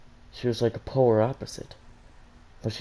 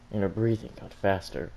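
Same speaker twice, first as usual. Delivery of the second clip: ordinary delivery, nothing marked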